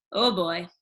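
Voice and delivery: ordinary delivery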